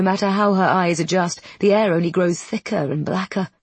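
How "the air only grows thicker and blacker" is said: ordinary delivery, nothing marked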